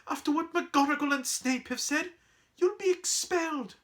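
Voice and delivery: very high, fretful warning; alarmed protest. Acted. Girl warning friend about expulsion, alarmed. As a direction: plead in a high, anxious voice.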